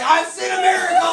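loud, high-pitched imitation holler; excited shout. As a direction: shout it out like an overexcited man in the crowd. Injured man shouting out in story.

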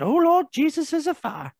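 high, loud hammed-up alarm; mock interviewee. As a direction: belt it out like the viral interview. Reciting viral news interview.

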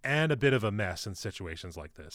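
ordinary delivery, nothing marked

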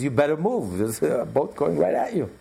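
acted high-ranking bluster; commanding admiral. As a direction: warn them off with authority. Admiral ordering ship to move.

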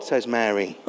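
ordinary talk

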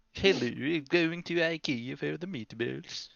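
slow, drawn-out fake Swedish; jokey Swedish caricature; mock Swedish accent. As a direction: put on a silly Swedish accent, taking it slowly. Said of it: "It's my Swedish".

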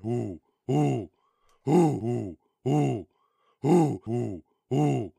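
loud repeated owl hoots; owl hooting. Pretend. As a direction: hoot loud like an owl, over and over. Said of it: "All you hear is me going".

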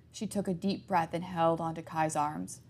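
ordinary delivery

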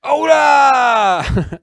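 high, loud shout of hola. Real, not acted. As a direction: belt out hola to the chat. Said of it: "say hola in the chat".

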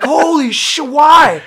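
high shout building to a loud "why"; retold van full of appalled yelling; erupting in shock. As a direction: burst out shouting in disbelief. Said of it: "The van erupted with".

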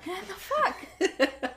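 high, very slow, laughing; laughing drawn-out echo. Real, not acted. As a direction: stretch it out while laughing.

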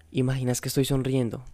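plain reading voice

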